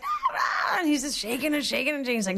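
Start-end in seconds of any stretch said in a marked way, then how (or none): 0.0-0.8 high-pitched squealing scream; imitated shriek while being shaken; mock scream. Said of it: "I'm just screaming".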